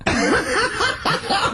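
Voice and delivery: high helpless squeal of laughter; can't hold in a shrill laugh; shrieking laugh. Real, not acted. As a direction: burst into a high-pitched cackle. Ricky cracks up at Carl.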